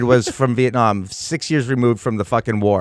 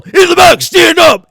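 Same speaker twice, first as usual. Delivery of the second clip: barked order, much higher and louder; drill sergeant yell; 12 dB louder than usual. Retold or imitated. Bus driver ordering kids to stand, drill sergeant yell. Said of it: "He'd yell".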